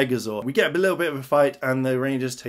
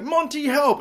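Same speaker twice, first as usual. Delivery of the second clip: high mock panicked yelp; a hammed-up call for help; mock cry for help; 12 semitones higher than usual. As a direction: squeak it out like he's being dragged off. Victor dragged into sea, calls.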